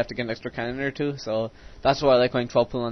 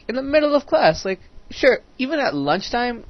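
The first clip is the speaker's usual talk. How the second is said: worked-up rant; 11 semitones higher, 4 dB louder than usual. Real, not acted. Outraged ranting about shaving.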